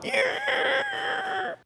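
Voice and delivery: sudden high strangled cry of pain; pained yelp. Retold or imitated. Preacher retelling stubbing his toe in the dark, high yelp. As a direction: yelp like you just stubbed your toe. Hopping around holding hurt toe.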